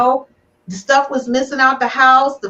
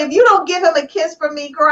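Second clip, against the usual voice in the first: usual speaking voice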